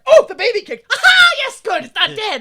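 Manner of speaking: high, loud giddy relief; mock elated relief. Mother hearing the baby kick, in high mock relief. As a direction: cheer that the baby kicked.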